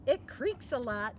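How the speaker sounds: plain reading voice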